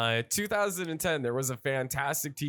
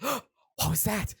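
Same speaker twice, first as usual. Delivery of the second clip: mock scared gasp, then slow higher question; startled victim; 7 semitones higher, slower than usual. Guys in an alley hearing the car, hammed-up fright. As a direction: gasp and ask it spooked.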